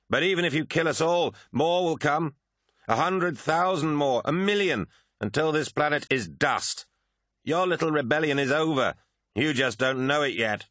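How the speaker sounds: high, ringing battle taunt; defiant warrior. Acted. Lucius tells Prahl his rebellion is over, high defiant warrior.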